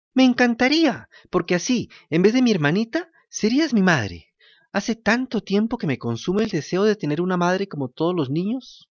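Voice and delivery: put-on high, eager wooden-puppet boy; a thirsty puppet boy begging politely. To the kind woman, Pinocho asks for water in a high puppet voice.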